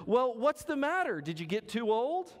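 high curious question, lightly voiced; a touch of puzzled man asking; voicing the man. Preacher voicing the man quizzing the dog, high and curious.

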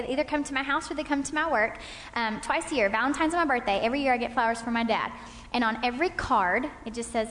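usual speaking voice

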